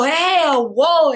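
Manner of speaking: retold slow jeer; mocking taunt. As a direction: drag it out to tease. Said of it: "So she go".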